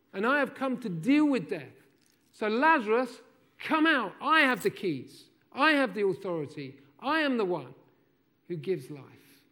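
loud, high, driving sermon delivery; preaching at full force. Acted. Preacher pressing the congregation toward the miracle, full force. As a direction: preach it to the rafters.

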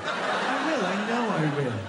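smug, falling to low; cocky reply. Acted. Childless partner boasting of good sleep, cocky. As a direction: gloat in total confidence. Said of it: "That's how cocky, how arrogant you are".

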